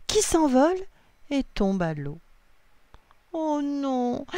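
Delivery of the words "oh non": high, drawn dismayed cry. A narrator's reading. Lily sees mum's hat fall in water, dismayed cry. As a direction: cry out as something precious is lost. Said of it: "s'écrie-t-elle".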